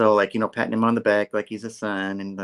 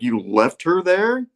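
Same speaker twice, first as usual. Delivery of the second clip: slow, higher-pitched disbelieving question; mock incredulous question; 8 semitones higher, 5 dB louder, slower than usual. [name] questioning Peter at lunch, mock incredulous. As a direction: draw it out in acted disbelief.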